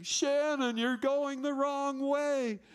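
loud, high, drawn-out shout; yelling. Retold or imitated. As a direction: yell a warning across a noisy game. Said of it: "And I'm yelling".

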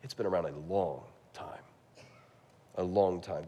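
low whisper to the congregation. Real, not acted. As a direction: hush it down to a whisper. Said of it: "whispered aside".

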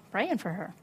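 ordinary talk

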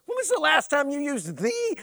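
ordinary delivery

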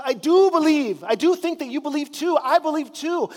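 a hint of a father crying out for help; father's exclamation. A narrator's reading. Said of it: "the boy's father exclaimed".

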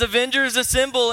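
loud, high, excited battle call; Thor. Acted. Preacher retelling Thor's entrance, loud and fired up. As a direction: shout the rallying line at full excitement. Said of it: "where big fat Thor comes in".

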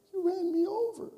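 high, whiny hurt-dog voice; squeaky injured dog complaint; the dog's voice. Retold or imitated. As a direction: play the wounded pup accusing you. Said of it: "and he was like".

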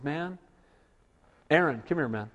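soft, quiet brotherly call; lightly voiced Moses drawing close; confiding. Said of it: "He says".

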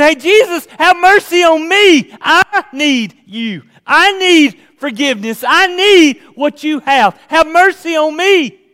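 loud, high, pleading shout; crying out. Shown as a demonstration. Preacher as the blind beggar begging Jesus for forgiveness, crying out. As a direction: shout each plea at full force. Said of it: "that just sounds a little quieter".

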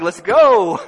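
loud, high, laughing "Let's go."; fiery shout. Shown as a demonstration. Pastor demoing her fiery style, a laughing shout. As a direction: shout it like a pep rally. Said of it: "more kick you in the gut".

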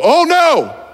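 high-pitched retold cry of dismay; mock alarm. Teenager seeing the pretzel bag burning, alarmed. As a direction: swoop up high in comic horror, without shouting.

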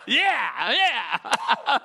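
high, loud contagious laugh breaking through a yeah; laugh on request. Shown as a demonstration. Pastor redoes his contagious laugh for the church, laughing loudly. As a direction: cackle it out again so the crowd catches it. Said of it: "Can you do that laugh again?".

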